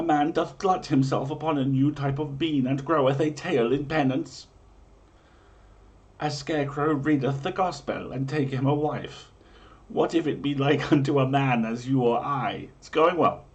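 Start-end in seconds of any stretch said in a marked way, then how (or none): none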